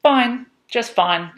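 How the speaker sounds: put-on high, slow koala child; drawn-out answer in a high little voice. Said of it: "said Koala Lou".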